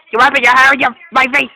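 loud, fed-up complaint. Real, not acted. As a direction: raise the voice in annoyed protest. Sister rubs hair in her face.

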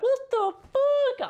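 very high, loud, slow cry of disbelief; stretched, high shocked yell; shocked outburst. Real, not acted. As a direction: scream the words out in pure shock. Shock at the blown-off fireball.

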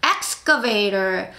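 excavator drawn out very slowly, each syllable clear; teacher modelling a word very slowly; slow model word. Shown as a demonstration. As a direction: stretch out excavator for learners to copy. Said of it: "시작".

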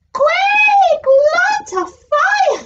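high, loud little pig shout; panicked pig. Acted. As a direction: cry out in alarm as the pig. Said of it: "said the pig".

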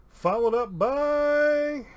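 loud, high, long-held BY; suspenseful reveal. Real, not acted. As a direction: announce it big, stretching the last word. Suspenseful card reveal to patron.

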